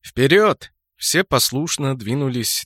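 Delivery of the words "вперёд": loud order to advance; commanding shout. Acted. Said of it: "скомандовал".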